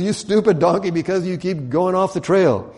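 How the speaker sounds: high, exasperated mock anger; upset scolding. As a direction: act out scolding a stubborn animal. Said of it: "Balaam gets real upset".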